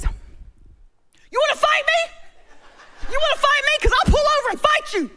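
hammed-up tough guy daring a fight; husband retold challenging a driver, mock angry; mock road-rage challenge. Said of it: "And David says".

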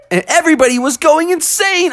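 high-pitched, thrilled storytelling; carried away by the big win; wildly excited narration. A narrator's reading. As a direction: tell it at the peak of excitement. Narrator acts out crowd going wild.

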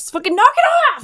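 high, loud exasperated yell; frustrated yell. Retold or imitated. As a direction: snap at the game in annoyance. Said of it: "and you're like".

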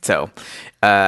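ordinary talk, nothing marked

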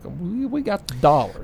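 high-pitched imitation of a comeback brag; mock boast. Host voicing Avatar bouncing back from flop talk, mock boast. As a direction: pipe up as the triumphant movie. Being like.

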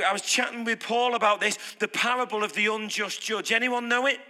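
ordinary talk

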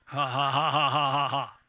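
low, rolling ha-ha-ha on purpose; deliberate laugh. Shown as a demonstration. Preacher leading the room to laugh at perfectionism, deliberate low laugh. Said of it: "Let's just laugh at that".

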